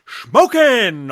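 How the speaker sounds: loud, high drawn-out catchphrase; catchphrase impression. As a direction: belt it like a movie catchphrase. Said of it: "doing, like, a lot of that stuff".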